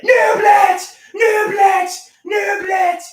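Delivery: high, loud chanted shouts; dance shout. Retold or imitated. Vlogger's poolside dance call, shouted high and loud. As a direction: shout the dance name over and over. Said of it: "Another one of my dances was the nublet".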